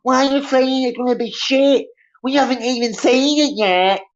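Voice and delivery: high, whiny nagging mock voice; mock whiny fan. Streamer imitating fans nagging him about She-Hulk, high whiny mock voice. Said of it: "you're all like".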